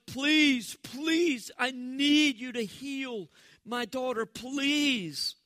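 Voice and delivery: loud, slow, pleading cries of a desperate mother; desperate begging. Retold or imitated. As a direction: beg him over and over with urgency. Voices mother begging Jesus.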